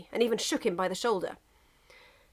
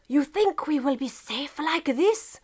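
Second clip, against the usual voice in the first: put-on drunken whisper; 6 semitones higher, slower than usual. A narrator's reading. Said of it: "Russia whispered drunkenly".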